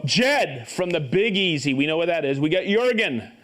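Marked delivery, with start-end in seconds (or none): none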